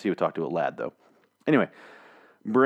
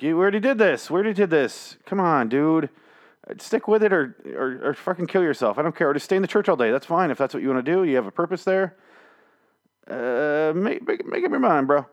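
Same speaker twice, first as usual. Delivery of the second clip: much higher, pleading, fed-up voice; exasperated rant; 7 semitones higher than usual. Retold or imitated. Fan scolding a comic character, high exasperated rant. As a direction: throw your hands up at the character, rising higher. Exasperated retort at Father Gabriel.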